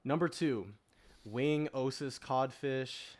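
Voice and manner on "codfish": ordinary talk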